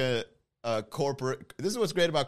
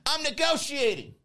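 Ronald McDonald negotiating; 12 semitones higher than usual. Pretend. Ronald on the phone with nato.

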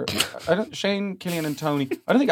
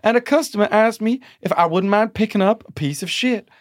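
Southern dame; 4 semitones higher than usual. Pretend. Host reading the maid story as a Southern dame. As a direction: read it like a scandalised Southern dame. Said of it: "Southern dame".

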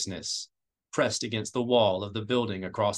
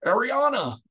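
a much higher, loud, stricken cry of a name; the sudden horror of remembering his wife; gasping cry of terror; gasped cry; 13 semitones higher, 6 dB louder than usual. A narrator's reading. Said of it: "he gasped".

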